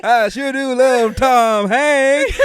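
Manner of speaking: loud folksy cowboy toy; Woody. Acted. Cowboy toy praising Tom Hanks, loud Woody impression. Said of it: "that's our boy Woody".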